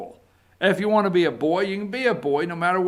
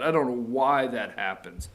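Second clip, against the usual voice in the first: ordinary talk, nothing marked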